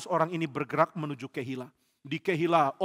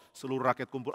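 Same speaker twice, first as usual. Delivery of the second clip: ordinary delivery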